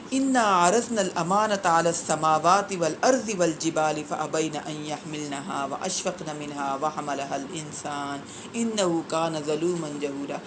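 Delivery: slow, measured Quranic recitation; tilawat-style reading, slow and devout. Acted. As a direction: recite the verse slowly, chanted. Quran verse recited in lecture.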